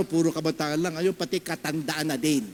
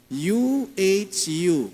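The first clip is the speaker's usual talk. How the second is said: spelled out slowly; much slower than usual. Shown as a demonstration. Reading chat letters slowly.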